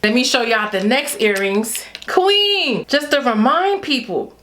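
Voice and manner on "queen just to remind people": normal talking voice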